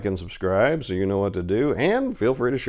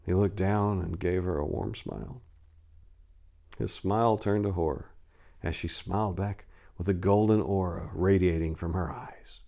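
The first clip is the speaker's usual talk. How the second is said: hushed narration; 3 semitones lower than usual. A narrator's reading. Quiet, hushed closing narration.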